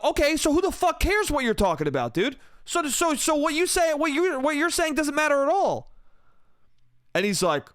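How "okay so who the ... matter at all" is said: high-pitched exasperated comeback, acted out; heated retort. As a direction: re-enact shutting down a drunk in an argument. Retold argument at pool table.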